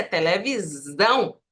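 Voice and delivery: televisão with a buzzing z; Portuguese Z sound. Shown as a demonstration. Teacher showing the Portuguese word, Z sound. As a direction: say televisão, drawing out the z. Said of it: "porque em português".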